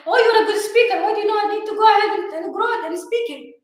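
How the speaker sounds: high, loud, rushed mock voice of a doubter; mimicking people who question her growth, high, loud and quick; mocking quote. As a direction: toss off the mock question high and fast, as if to say why bother. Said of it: "some people be like".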